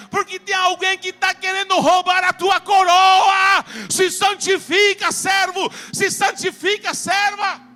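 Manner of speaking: high, loud sustained shouting, long-held words; full-force preaching. Real, not acted. Preacher crying Revelation's warning to God's servants, full-force shout. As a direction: build to a roaring sermon climax.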